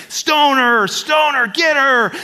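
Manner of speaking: high, loud mob cry; a scribe shouting at the crowd to stone her; eager shout. Acted. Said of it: "he's like".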